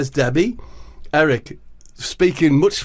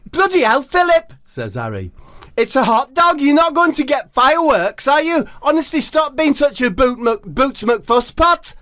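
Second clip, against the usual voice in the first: high exasperated Harry; 12 semitones higher than usual. Acted. Harry telling fussy Philip off, high. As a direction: scold him, voice up high.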